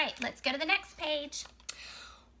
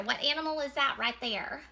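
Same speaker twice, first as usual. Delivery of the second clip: usual speaking voice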